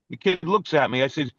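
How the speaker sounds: ordinary delivery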